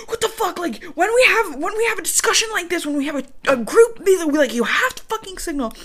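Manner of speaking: high, exasperated acted rant; pissed-off scolding. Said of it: "She's fucking pissed".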